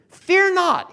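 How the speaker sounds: loud, high preacher's proclamation; proclaimed. Acted. Preacher proclaims what Joseph said, loud and high. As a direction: ring it out to the congregation.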